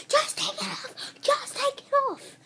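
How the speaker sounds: high whiny mock yell; whiny pleading mock viewer voice; imitating viewers yelling. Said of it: "you're probably yelling at me".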